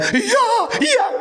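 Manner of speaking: high, laughing put-on reply of the widow; mock grieving widow. Preacher imitating the widow answering her comforters, high and laughing. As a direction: squeak it out like the brushed-off widow. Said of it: "Lalu dia mengatakan".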